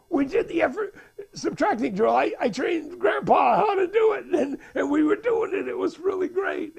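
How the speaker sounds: put-on high, excited kid reporting back; enfant tout excité. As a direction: gush breathlessly like a thrilled boy. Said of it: "he's all excited".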